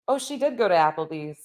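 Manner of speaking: usual speaking voice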